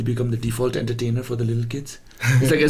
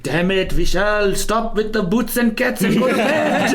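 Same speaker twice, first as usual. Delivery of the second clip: nagging parent; 8 semitones higher, 3 dB louder, slower than usual. Retold or imitated. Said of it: "your parents are just like".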